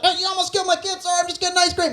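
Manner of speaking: high-pitched mock outcry; panicked exchange. Comic replaying a near accident in a parking lot, high mock panic. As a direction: act out a frantic, high-voiced confrontation.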